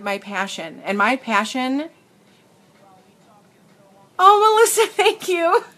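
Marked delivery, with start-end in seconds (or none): 4.2-5.6 touched laughing thanks. Real, not acted. Moved by a viewer's kind comment.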